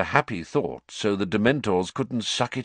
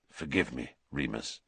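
quiet apology; 9 semitones lower, 10 dB quieter than usual. Acted.

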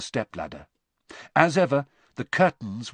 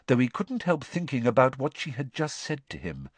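usual speaking voice

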